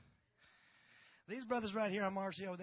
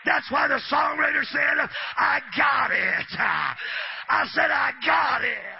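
loud, driving sermon cadence; shouting preacher building to a peak; full-force preaching; 6 semitones higher, 21 dB louder than usual. Acted. Preaching climax.

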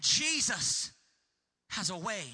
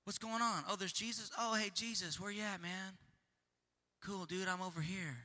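casual; 3 semitones lower, 11 dB quieter than usual. Retold or imitated. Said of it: "He didn't just sit there and go".

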